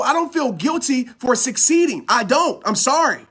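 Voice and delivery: ordinary delivery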